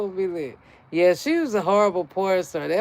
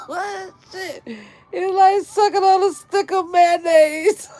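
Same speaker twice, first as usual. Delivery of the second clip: high, loud laughing outburst; loud giggling exclamation; 11 semitones higher, 6 dB louder than usual. Real, not acted. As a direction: shriek it through laughter. Punchline said while laughing.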